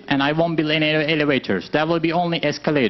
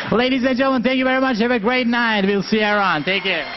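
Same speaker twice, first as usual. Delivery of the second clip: announcer sign-off; 5 semitones higher than usual. Real, not acted. Show host signs off.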